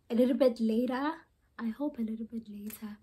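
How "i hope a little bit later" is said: soft voiceless aside; quiet whispered hope. Real, not acted. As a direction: whisper it to yourself. Whispered aside about editing.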